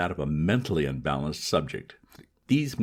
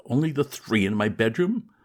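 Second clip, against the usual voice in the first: higher, louder disbelieving repeat rising on bedroom; incredulous echo; 5 semitones higher, 5 dB louder than usual. Acted. Young master repeating his valet's words, higher, in disbelief. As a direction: echo the servant's words in horror.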